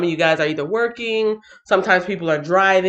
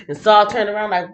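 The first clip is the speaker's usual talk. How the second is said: ordinary talk, nothing marked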